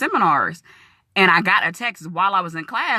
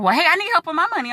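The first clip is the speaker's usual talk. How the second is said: eager request; 12 semitones higher, faster than usual. Retold or imitated. Another woman wants help.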